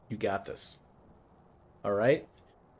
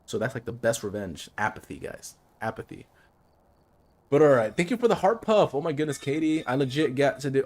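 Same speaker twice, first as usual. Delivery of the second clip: usual speaking voice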